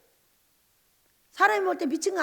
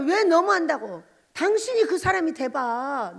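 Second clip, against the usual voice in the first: normal talking voice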